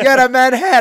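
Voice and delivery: loud, high mock Scottish accent. Scottish woman confirming the insult, loud imitated Scottish accent. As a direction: belt it out in a put-on brogue. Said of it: "my best Scottish accent".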